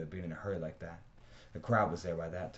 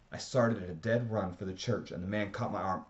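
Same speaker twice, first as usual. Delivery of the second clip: ordinary delivery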